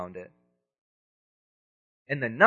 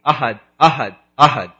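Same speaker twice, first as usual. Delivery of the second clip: loud, forceful repeated cry; emphatic declaration; 2 semitones lower, 10 dB louder than usual. Acted. Bilal pinned under hot rocks, defiant loud cry. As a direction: declare each one hard and loud.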